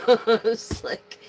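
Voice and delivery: chuckling at herself; laughing. Real, not acted. Reader laughing about her lost cards, laughing. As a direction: laugh through it, slowing down.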